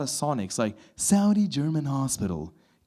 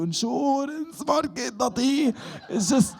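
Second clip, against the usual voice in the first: mock squeak; 12 semitones higher, slower than usual. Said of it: "It's like".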